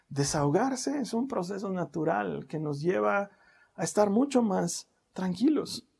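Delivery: ordinary delivery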